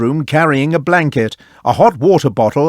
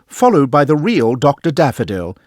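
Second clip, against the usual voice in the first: ordinary delivery, nothing marked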